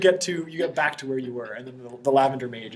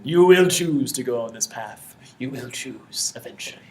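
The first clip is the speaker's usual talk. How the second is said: slow, portentous pronouncement, sinking to a hushed end; cryptic mage; 4 dB louder, slower than usual. Acted. Mage foretells the seeker's choice, slow and cryptic.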